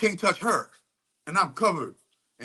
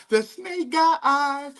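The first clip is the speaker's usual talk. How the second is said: much higher and slow, stretched out for effect; drawn-out ominous; 9 semitones higher, slower than usual. Real, not acted. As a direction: draw it out high and slow like a warning. Repeated ominously high and slow.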